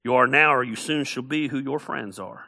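usual speaking voice

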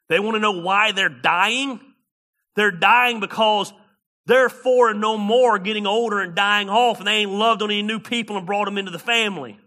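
rising pulpit fervor; full-force preaching. Acted. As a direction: preach it hard to the congregation.

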